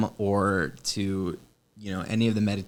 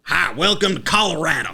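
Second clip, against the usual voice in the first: put-on raised, friendly cowboy-accent greeting; mock cowboy accent; 4 semitones higher, 4 dB louder than usual. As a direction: caricature Western accent, pitched up. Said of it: "that's what everyone should say".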